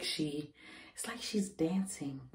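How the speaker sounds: hushed whisper. Real, not acted. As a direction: breathe the words softly. Whispering while baby kicks.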